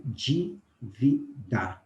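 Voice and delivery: dívida drawn out, with dji at the start; slow dívida. Shown as a demonstration. Teacher slowly modelling dívida for the class. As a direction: stretch out dívida, first d as dji. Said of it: "olha que interessante".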